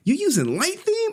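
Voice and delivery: high-pitched mock disbelief; mock outrage. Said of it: "You just dissing me and shit. Like".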